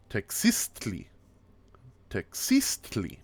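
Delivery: TEXISTLI as tek-SEEST-li, middle punched; stress on SIS. Shown as a demonstration. Teacher models the word for egg, stressed demo. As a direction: lean hard on the second syllable of TEXISTLI. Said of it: "It's on that cis".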